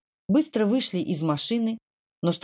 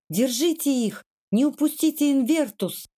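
mild cry of pursuit, pitched higher; крики преследователя; 6 semitones higher than usual. A narrator's reading. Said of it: "преследуемые криками Квардли". (сдержанный крик вдогонку, голос выше)

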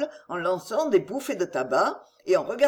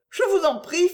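high, louder urgent plea; a lady begging him, pitched up; noblewoman's plea, higher; Marguerite pleading; 10 semitones higher, 3 dB louder than usual. Acted.

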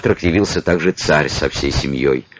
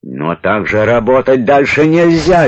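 furious, higher raised shout; berserk rage; 6 semitones higher than usual. Acted. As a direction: yell it in a fury. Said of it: "в бешенстве заорал Климович". (яростный крик)

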